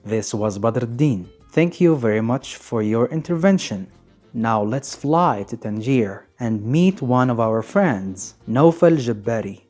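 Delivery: ordinary delivery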